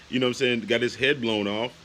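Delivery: usual speaking voice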